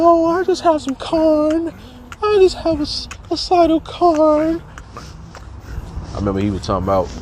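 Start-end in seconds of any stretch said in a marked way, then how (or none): 0.0-4.6 high slow squeaky voice, much higher; mock teacher. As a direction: slowly squeak it with fake innocence. Imitating teacher in lunchroom.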